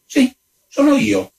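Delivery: high-pitched, proud countess. A narrator's reading. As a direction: answer high with lofty pride. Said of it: "fece la contessa con fierezza".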